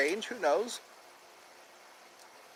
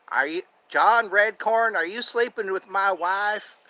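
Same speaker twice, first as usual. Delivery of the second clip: higher, suspicious cartoon voice; Dale Gribble; 4 semitones higher, 3 dB louder than usual. Accusing John Redcorn over his wife, higher cartoon impression. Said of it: "the voice for Dale Gribble".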